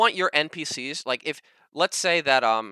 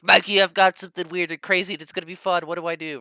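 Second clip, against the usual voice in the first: excited; 6 semitones higher, 6 dB louder than usual. Retold or imitated. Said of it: "me being like".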